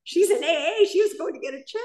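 high, amazed retelling of the news; lightly voiced excited brother; excited gossip. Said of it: "He's like".

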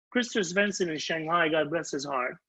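normal talking voice

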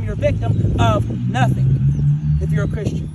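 slow, loud and low, driving it home; emphatic preaching. Real, not acted. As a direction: pound each word from the pulpit. Preacher hammering his point home.

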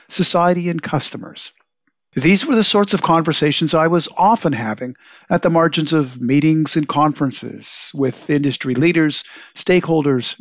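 scripted radio host read; podcast intro read. Acted. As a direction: read it like a show opener.

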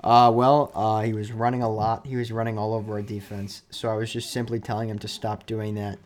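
low, quiet deadpan answer; soft, low-key mock explanation; imitated coach at press conference. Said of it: "and he just goes".

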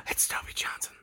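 hushed, pretend courtroom gasp; mock shocked whisper. Courtroom crowd reacting to the witness, mock gasping whisper. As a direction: whisper it like a scandalized onlooker.

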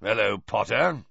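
put-on a lightly growled greeting from a gruff old auror. A narrator's reading. Gruff professor greets a student in the corridor, growling. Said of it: "growled Moody".

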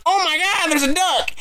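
high-pitched retold panic; panicked excitement. Hunter retelling his panic at a duck, high and excited. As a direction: squeak it out like you just spotted a duck.

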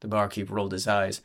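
plain reading voice

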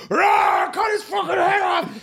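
high loud battle-cry yell; battle shout. Retold or imitated. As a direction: roar it like a soldier in a melee. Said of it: "but it was like".

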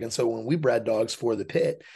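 usual speaking voice

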